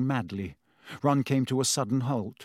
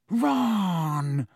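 moaning; 5 semitones higher than usual. Acted. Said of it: "Hermione moaned".

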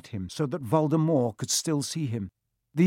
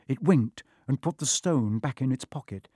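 ordinary delivery, nothing marked

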